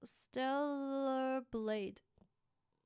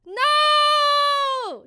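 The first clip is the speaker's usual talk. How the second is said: alarmed shout; 13 semitones higher, 15 dB louder than usual. Real, not acted. Stream goes wrong suddenly.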